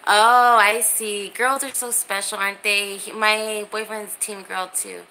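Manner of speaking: ordinary delivery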